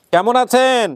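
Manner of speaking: high, loud call; to someone hard of hearing. Real, not acted. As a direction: raise your voice for her. Host calls to deaf mother.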